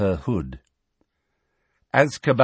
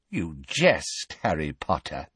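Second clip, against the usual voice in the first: cold witch; 5 semitones lower than usual. A narrator's reading. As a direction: let the smile drop, cold. Bellatrix, grin fading.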